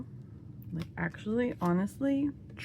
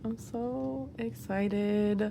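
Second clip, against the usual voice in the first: slow drawn-out excitement; much slower than usual. Real, not acted.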